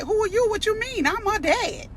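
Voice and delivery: high, loud offended protest; father outraged at being questioned; mock indignant dad. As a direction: play the insulted father demanding answers. Two dads quarrel at school desk.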